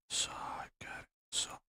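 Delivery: urgent whisper to the dogs, get inside; whispered command. Real, not acted. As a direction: hiss it low so nobody else hears. Shooing the dogs inside quietly.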